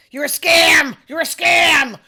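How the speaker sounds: loud, high mimicked accusation; imitated angry yelling. Retelling a man calling her a scammer, imitated yelling. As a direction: yell it like an irate customer, stretching "scam". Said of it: "Do I get screamed at every day?".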